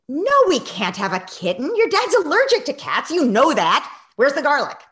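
loud, high, impatient brush-off; tense refusal. Retold or imitated. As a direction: snap at her while busy cooking. Tense mother refusing while cooking.

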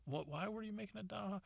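high incredulous question; puzzled son. Retold or imitated. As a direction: ask it in wide-eyed disbelief. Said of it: "he goes".

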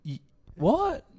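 high, stammered mock disbelief; halting question, acting totally baffled; confused. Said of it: "just confused as fuck".

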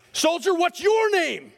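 mock yell of a general repeating the demand; yelled command. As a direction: bark each word slowly at the sleeping guard. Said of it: "I'm gonna yell here for a second".

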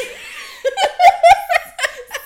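loud, high-pitched belly laugh; real shrieking laugh; burst of laughter. Real, not acted. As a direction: crack up helplessly at full volume. Cracks up at being moist.

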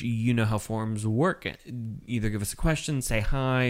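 usual speaking voice

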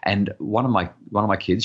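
ordinary talk, nothing marked

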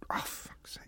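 frustrated whisper; whispered curse. Real, not acted. Frustrated aside under breath.

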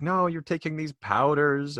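lightly voiced disapproving mother; worried mom. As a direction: imitate a fretting mom. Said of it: "She'd be like".

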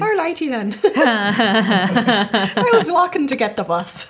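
a lightly put-on Irish accent, laughing through it; slow Irish lilt breaking into giggles. Reading a listener's story aloud in a giggly Irish accent. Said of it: "Please do an Irish accent".

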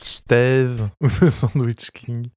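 slow, drawn out with laughter; over enunciating. Acted. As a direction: stretch the words, laughing. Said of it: "je vais parler très lentement".

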